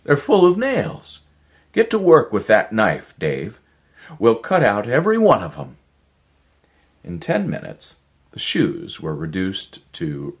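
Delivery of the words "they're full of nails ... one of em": much higher and louder, fast and urgent; excited idea. Acted. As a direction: blurt the plan out in a rush. Erickson's sudden idea.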